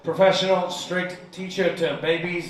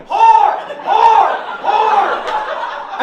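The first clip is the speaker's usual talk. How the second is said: shrill-high, much louder repeated yell; child screaming; 10 dB louder, much slower than usual. Retold or imitated. Little Chinese girl screaming the word at class, high yell. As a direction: scream it like a gleeful four-year-old. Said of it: "just screaming".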